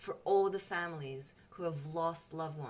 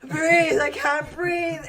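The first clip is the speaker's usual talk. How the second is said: much higher and much louder, gasped out; clawing out of the seatbelt in panic; panicked gasping; 9 semitones higher, 14 dB louder, slower than usual. Retold or imitated. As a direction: pretend you're suffocating, panicking. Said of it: "coming out of my seatbelt going".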